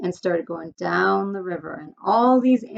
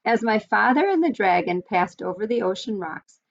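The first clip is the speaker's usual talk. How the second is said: plain reading voice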